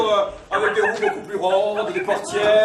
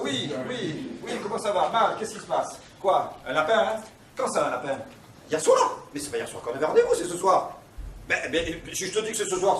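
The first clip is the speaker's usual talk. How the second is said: normal talking voice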